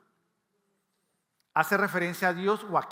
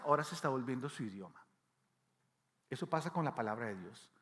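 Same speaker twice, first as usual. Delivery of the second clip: ordinary delivery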